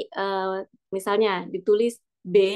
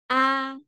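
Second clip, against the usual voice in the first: "A" high and level; first-tone demo; 4 semitones higher than usual. Shown as a demonstration. Teacher running through four tones, high level "A". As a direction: hold "A" on a high, steady note. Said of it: "Berarti kalau kita simpulkan".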